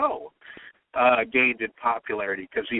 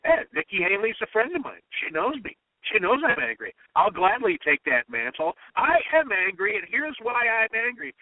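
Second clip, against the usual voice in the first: defiant Trump quote; 7 semitones higher than usual. Retold or imitated. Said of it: "He goes".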